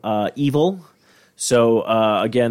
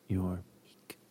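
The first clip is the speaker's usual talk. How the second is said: whisper; 4 semitones lower, 12 dB quieter than usual. Pretend. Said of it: "whispered question to Anna".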